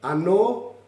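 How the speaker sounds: "Anō" rising at the end; "Anō" said as a rising question; voice going up. Shown as a demonstration. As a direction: lift "Anō" like a request to repeat. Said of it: "Hear the voice go up".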